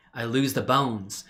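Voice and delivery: usual speaking voice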